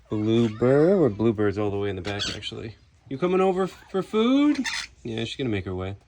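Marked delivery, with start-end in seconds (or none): 3.1-4.6 high coaxing call. Real, not acted. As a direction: coax the bird over. Calling a bird over.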